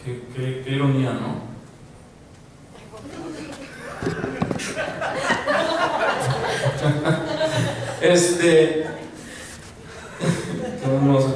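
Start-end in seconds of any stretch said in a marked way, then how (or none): 2.9-8.0 long high real laugh, starting quiet; laughter. Real, not acted. Preacher losing his thread before the congregation, laughing. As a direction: lose it in helpless laughter. Breaks up during the sermon.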